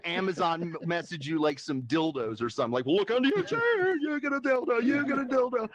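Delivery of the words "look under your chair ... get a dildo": high, sing-out prize announcement; mock giveaway host. Comic handing out imaginary prizes to the crowd, mock host's cheer. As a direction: call it out high like gifting a whole audience.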